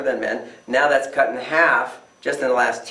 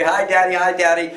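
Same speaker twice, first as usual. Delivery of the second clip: put-on higher, louder eager children's chatter; kids greeting excitedly; 6 semitones higher, 4 dB louder than usual. Children run to greet dad at the door, imitated higher and louder, excited. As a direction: mimic the kids rushing up to Dad.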